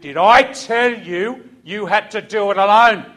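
slow, loud deliberate sergeant; pointed question. Retold or imitated. Sergeant nose to nose with Raymond, slow and loud. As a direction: lean in and drive it home slowly.

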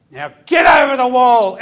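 loud high shout; shouted order. Retold or imitated. Sergeant shouts at the squad, loud. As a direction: shout the command.

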